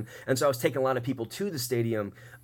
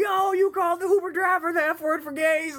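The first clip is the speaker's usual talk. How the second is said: much higher mocking bro voice; laughing friends teasing. Friends mocking their buddy in the Uber, high taunting. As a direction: tease the friend in a high drunk voice. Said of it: "They're laughing at their friend".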